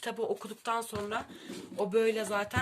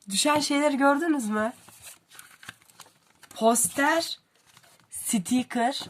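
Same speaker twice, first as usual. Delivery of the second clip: higher and louder, thrilled; excited question; 7 semitones higher, 8 dB louder than usual. Real, not acted. Booktuber showing viewers the fallen extras, thrilled and louder.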